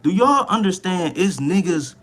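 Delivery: hyped up. Real, not acted. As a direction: sell the wonder of it to the viewers. Hyped about gamers becoming millionaires.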